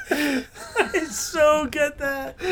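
laughing through the words, slow, high; giggling repeat. Real, not acted. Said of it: "That's funny".

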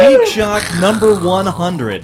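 loud, slow show-host welcome; announcer opening. Acted. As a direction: boom out the show name like an announcer.

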